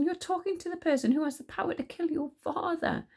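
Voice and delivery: high-pitched anxious warning; agonized. A narrator's reading. Caliburn warning Tsar about his father's danger, agonized. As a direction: plead anxiously, pitched right up. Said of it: "said Caliburn in an agonized fashion".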